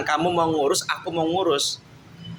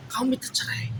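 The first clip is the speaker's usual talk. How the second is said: whispered accusation; 5 semitones higher, 5 dB quieter than usual. Real, not acted. Husband accusing wife.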